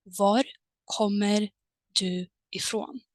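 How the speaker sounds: Var, kommer, du ifrån spoken as separate paused chunks; split word by word. Shown as a demonstration. Teacher splitting Var kommer du ifrån into parts. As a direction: pause between each part. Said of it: "meaning is exactly the same".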